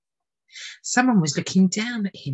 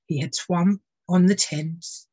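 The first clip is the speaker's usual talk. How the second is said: plain reading voice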